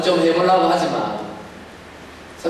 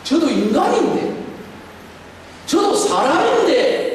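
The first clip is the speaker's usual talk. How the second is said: much higher, slow plea; pleading lament; 10 semitones higher than usual. Real, not acted. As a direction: cry out that he is only human. Pastor pleading his weakness.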